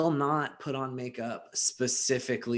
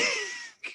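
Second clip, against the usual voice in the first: squealing laugh; 6 dB quieter than usual. Real, not acted. Laughs at own line.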